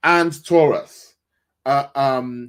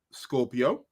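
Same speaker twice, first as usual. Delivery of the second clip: ordinary talk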